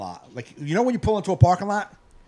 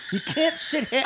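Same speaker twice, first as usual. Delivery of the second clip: ordinary talk, nothing marked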